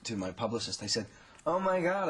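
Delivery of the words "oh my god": yell across the room. Retold or imitated. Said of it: "I kind of yelled across the room".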